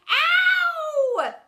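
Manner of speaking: very high, loud pained scream. Acted. Teacher sitting on drawing pins, screaming. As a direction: yelp as the pins stab you. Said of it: "screamed Mister Phoebe".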